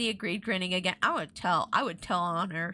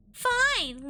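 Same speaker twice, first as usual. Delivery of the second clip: curt; 12 semitones higher than usual. A narrator's reading. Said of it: "Lindy replied curtly".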